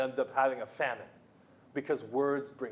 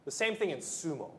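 ordinary talk

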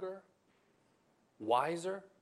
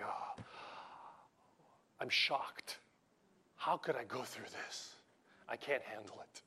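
self-pitying whimper; 10 dB quieter than usual. Retold or imitated. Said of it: "it's like".

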